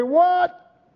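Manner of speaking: high startled cry of what; shocked what. Retold or imitated. Crowd cut to the heart crying out, startled. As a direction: yelp it, as if the truth just hit.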